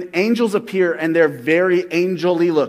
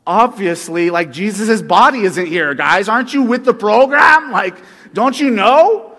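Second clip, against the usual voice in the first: louder, higher exasperated retort; mock Mary talking down to the angels; sassy Mary; 4 semitones higher, 10 dB louder than usual. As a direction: scoff at the angels like they're clueless. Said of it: "And she's like".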